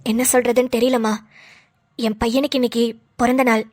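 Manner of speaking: low, grief-worn voice; voice gone weak. Acted. To her mother, low and heavy with sorrow. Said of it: "உன் குரல் இப்படி ஒய்யின்னு போயிருச்சு".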